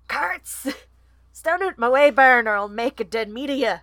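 fierce Scottish falcon; Scottish accent. Acted. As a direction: threaten in a forceful Scottish accent. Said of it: "He's Scottish".

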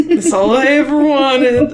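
slow happy line through laughter; laughing. Real, not acted. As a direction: laugh it out, delighted. Laughing after head pats.

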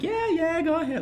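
very high, sing-song brush-off; high pitch. Retold or imitated. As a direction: squeak it out sweetly. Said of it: "like, high pitch".

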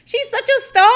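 high, loud gushing praise; a lightly voiced starstruck brother; mock gushing brother. Imagining her brother praising her, mock high gushing. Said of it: "they were like".

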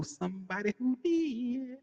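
high, slow taunting lilt; teasing singsong. Acted. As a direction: needle him with a knowing tease. Teasing his cohost.

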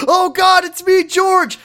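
very high, loud hammed-up wail; mock panic. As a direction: cry out like a cartoon victim pleading. Acting George panicking at gunpoint.

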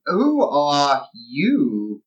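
very slow, drawn-out question; intrigued. Acted. Dawn looks Casney up and down, slowly intrigued. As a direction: stretch every word as you size them up.

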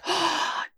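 sharp acted intake of breath; mock horrified gasp. Imitating Eleanor on seeing the horse, mock gasp. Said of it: "Eleanor immediately being like".

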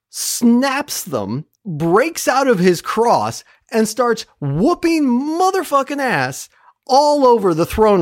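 high, drawn-out, triumphant emphasis; hyped epic retelling. Real, not acted. Podcaster retells the hero breaking his chains, hyped and triumphant. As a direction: stretch out each blow with relish.

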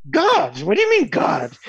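high-pitched, incredulous mock Englishman; indignant Englishman. Englishman challenged by Indian farmer, mock indignation. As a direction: play the baffled English invader, pitched right up. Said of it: "And the Englishman says".

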